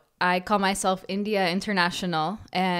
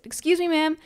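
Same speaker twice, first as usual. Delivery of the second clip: retold polite call-out; 9 semitones higher than usual. Patient woman to line cutter, pitched-up polite excuse me. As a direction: raise the voice to get her attention. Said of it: "So I said".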